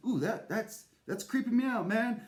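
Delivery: high panicky voice; mock freaking out. Cave person panicking at the pulse in his chest, high voice. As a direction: act spooked, pitch way up. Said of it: "I'm freaking out".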